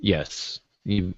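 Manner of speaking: normal talking voice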